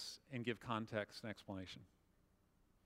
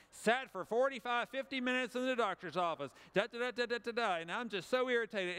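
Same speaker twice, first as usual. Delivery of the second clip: higher, louder exasperated rant; irritated griping; 6 semitones higher, 3 dB louder than usual. Shown as a demonstration. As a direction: complain like a cranky post. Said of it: "and you type".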